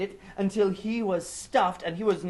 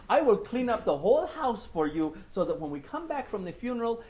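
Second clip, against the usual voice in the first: high scheming sweet talk; 7 semitones higher, 8 dB louder than usual. Acted. Anansi sweet-talking his wife.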